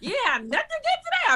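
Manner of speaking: high-pitched mock disbelief; incredulous. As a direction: question it as wildly exaggerated. Mock disbelief continues after laugh.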